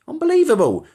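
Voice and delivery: high, loud outraged cry of disbelief; exasperated. Real, not acted. Fake account outdoes his own, exasperated high cry. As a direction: throw it up in disbelief.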